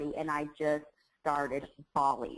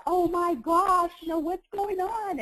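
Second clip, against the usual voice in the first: imitating friends' high, slow, drawn-out gush; mock gushing of arriving friends; excited friends; 12 semitones higher than usual. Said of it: "they were like".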